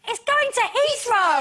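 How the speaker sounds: high, slowed-down mock exasperation; exasperated correction. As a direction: spell it out slowly, imitating a fed-up husband. Husband deflating her plane daydream.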